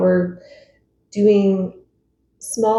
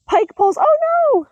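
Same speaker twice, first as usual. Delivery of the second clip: startled cry; 4 dB louder than usual. Real, not acted. Sudden fall on camera.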